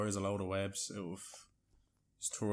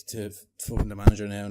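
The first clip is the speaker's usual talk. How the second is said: usual speaking voice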